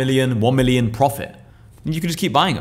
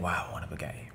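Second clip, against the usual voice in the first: whispered thought; 7 semitones lower, 17 dB quieter than usual. Retold or imitated. Imagined thought of a younger self.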